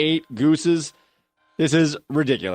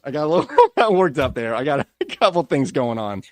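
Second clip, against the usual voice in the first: normal talking voice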